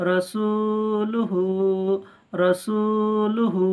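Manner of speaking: রসুলুহু chanted very slowly with long vowels; drawn-out recitation. Shown as a demonstration. The teacher recites রসুলুহু to viewers, drawn out. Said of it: "এক আলিফ টান দিয়ে পড়তে হবে".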